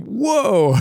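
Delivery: high, amazed whoa; exclaiming. Acted. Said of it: "Then I heard him exclaim".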